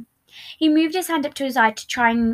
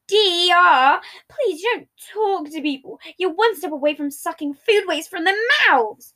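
contemptuous; 7 semitones higher, 5 dB louder than usual. Acted. Patton mocking in argument.

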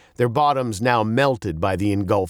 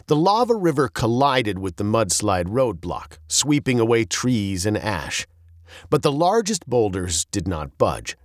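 plain reading voice

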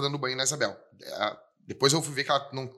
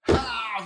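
high, loud gasped "ah"; imitated startle cry; 9 semitones higher, 3 dB louder than usual. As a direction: act out a sudden fright. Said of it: "fiz assim". (susto imitado, agudo)

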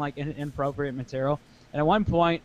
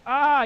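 mock scream; 11 semitones higher, 6 dB louder than usual. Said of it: "she goes".

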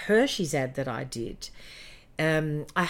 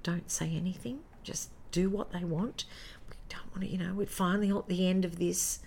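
soft, half-whispered caution; whispered producer warning; hushed warning; 3 semitones higher, 6 dB quieter than usual. Retold or imitated. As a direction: warn her under your breath. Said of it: "producer had taken me aside and said".

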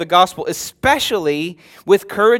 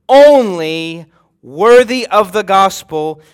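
"only" stressed, louder and slow; emphatic only; 6 dB louder, slower than usual. Acted. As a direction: lean hard on "only", drawing the phrase out. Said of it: "it is emphatic".

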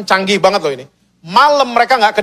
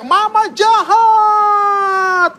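very high, long-drawn hammed-up wail; mock scream; 13 semitones higher, much slower than usual. Falling eaglets screaming at their mother, hammed-up drawn-out scream. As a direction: scream it like a falling chick, stretched right out. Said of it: "mereka akan teriak".

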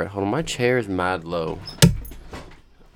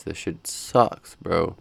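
ordinary talk, nothing marked

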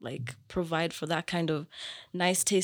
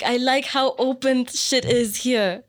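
normal talking voice

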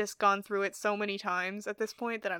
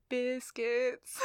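slow, drawn-out southern "biscuits"; southern accent; 5 dB quieter than usual. Shown as a demonstration. Relishing the one word with friends, southern accent. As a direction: stretch biscuits out southern-style. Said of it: "fun to say with a southern accent".

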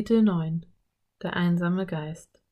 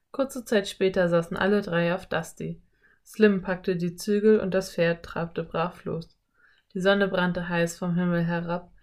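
usual speaking voice

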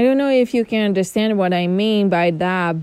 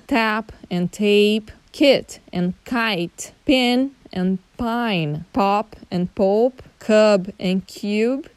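each pair read slowly and clearly; slow listing of contrast pairs; slow word pairs; slower than usual. Shown as a demonstration. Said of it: "tons of words".

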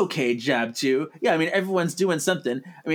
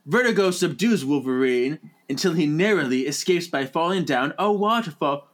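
usual speaking voice

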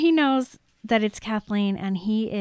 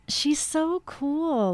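drawn-out, higher admiring coo; gushing admiration; 5 semitones higher, much slower than usual. Acted. Friend gushing about how cool she is, admiring. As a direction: draw it out, high and admiring. Acting out Kathleen admiring the girl.

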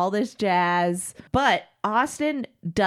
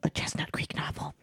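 whispering; 5 semitones lower, 8 dB quieter than usual. Acted. Dramatic whispered book title.

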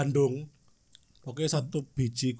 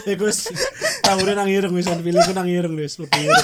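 laughing; 2 semitones higher, 6 dB louder than usual. Real, not acted. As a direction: squeal through the words. Laughing while speaking.

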